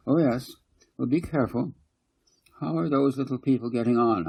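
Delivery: put-on caring mum checking on her girls; mother's voice.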